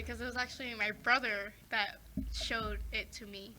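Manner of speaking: ordinary delivery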